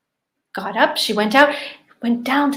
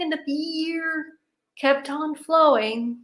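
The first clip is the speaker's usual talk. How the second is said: ordinary delivery, nothing marked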